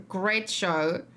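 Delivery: ordinary talk, nothing marked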